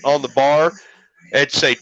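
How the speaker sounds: ordinary delivery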